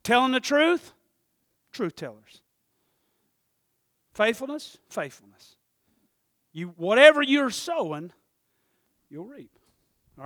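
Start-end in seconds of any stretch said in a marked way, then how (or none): 4.1-4.8 Faithfulness lifted high and louder, rising at the end. Shown as a demonstration. Pastor calls the word out high to the church. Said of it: "repeating the word two ways". 4.9-5.6 falling echo. Shown as a demonstration. Pastor echoes the word low to the church. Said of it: "repeating the word two ways".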